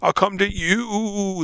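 acted Billy. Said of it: "and he's like".

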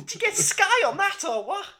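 very high-pitched Scouse question; confused Scouse lad. Acted. Confused Scouse lad quizzing about the telly, high Scouse. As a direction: ask in squeaky, exaggerated Scouse. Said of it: "confused Scouse lad".

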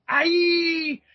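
high mock scream of falling. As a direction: yelp like a man dropping into acid. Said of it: "and a scream".

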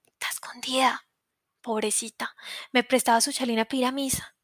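soft unvoiced whisper; whispered pity. Girl whispering about the hidden young mother. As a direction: whisper it like a secret.

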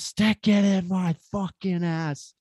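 high, drawn-out retold scream, held back in volume; mock yell. Storyteller recalling the shout out of the darkness, restrained mock yell. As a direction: replay the yell without full force. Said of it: "out of the darkness, just".

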